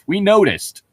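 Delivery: high, loud mock voice, dry; sarcastic jab. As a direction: needle him with a squeaky snark. Sarcastic jab at undead narrator.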